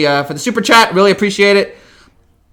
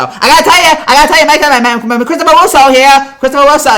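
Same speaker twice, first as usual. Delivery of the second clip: loud, high, brash sports-radio host voice; hammed-up Mad Dog Russo shout; terrible Mad Dog impression; 7 semitones higher, 4 dB louder than usual. Streamer doing his bad Mad Dog Russo impression for chat. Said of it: "that's my terrible Mad Dog impression".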